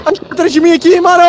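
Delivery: high panicked cries; being chased. Real, not acted. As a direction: yelp in panic as it comes. Monster behind him.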